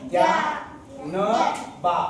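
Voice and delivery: ज्ञा-न-बा drawn out, each syllable held apart; slow, separated syllables in ज्ञा-न-बा. Shown as a demonstration. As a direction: sound out ज्ञा-न-बा very slowly for the class. Teacher sounding out word for class.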